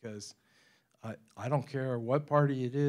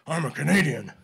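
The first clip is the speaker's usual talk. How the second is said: much louder, firm and declarative; firm Clint Eastwood; 2 semitones higher, 11 dB louder than usual. Shown as a demonstration. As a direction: stand your ground and say it hard. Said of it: "that's the way you have to answer those guys".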